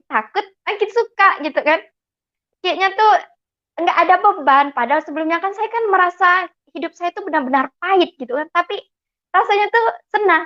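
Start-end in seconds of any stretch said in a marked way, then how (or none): none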